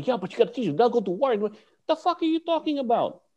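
high, heated retold comeback; argumentative protest. Student arguing back at his teacher, high indignant protest. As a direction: argue back with pitch climbing.

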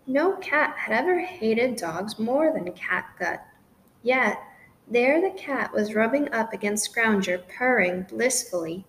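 usual speaking voice

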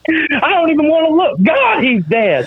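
high-pitched mock commentator's horror; Fowler. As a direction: cry out like an aghast wrestling announcer, hammed up. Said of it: "was it Fowler going".

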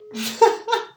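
squealing laugh; high-pitched laugh. Real, not acted. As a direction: burst into a high giggle. High laugh after the call.

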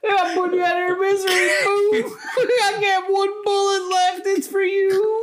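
high, fast, laughing through the quote; laughing mock quote. Quoting her warning to Adam, high and laughing. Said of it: "She kept warning Adam".

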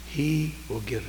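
ordinary talk, nothing marked

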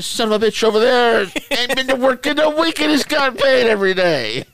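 loud, high-pitched indignant rant; outraged protest. Retold or imitated. Worker protesting an absent colleague's pay, outraged. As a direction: yell the injustice as a retold comeback. Retold protest at being scolded unfairly.

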